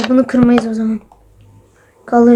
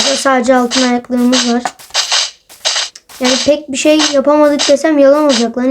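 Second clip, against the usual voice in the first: ordinary delivery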